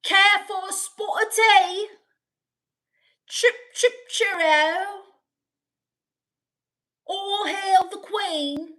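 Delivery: very high-pitched cartoon British; British accent. Shown as a demonstration. Streamer doing stock British phrases for chat, high mock British. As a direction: squeal it out in hammed-up British. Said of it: "Can you do British ones?".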